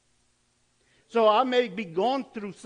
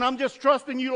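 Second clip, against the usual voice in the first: loud, higher, pushy "trusting" said as a demand; mock demanding prayer; 6 semitones higher, 7 dB louder than usual. Selfish believer loudly demanding from God. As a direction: push the word trusting at God, loud and high. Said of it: "They're saying".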